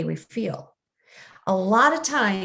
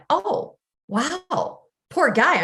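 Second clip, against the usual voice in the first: higher, sudden sympathy for the driver; sympathetic; 6 semitones higher, slower than usual. Retold or imitated. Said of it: "I have this insight and went".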